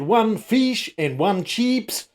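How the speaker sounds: very slow, exaggerated Australian vowels in "one fish and one chips"; careful Aussie-style. A Kiwi ordering so the Australian server understands, very slow and over-careful. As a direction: spell it out slowly the Australian way. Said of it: "they understood exactly what I meant".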